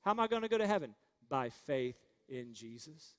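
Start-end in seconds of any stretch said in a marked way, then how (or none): 1.3-3.1 slow and low, word by word; leading a recitation. Shown as a demonstration. Preacher leading the congregation in response, slow and low. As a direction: lay it out slowly to be repeated. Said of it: "Say that with me".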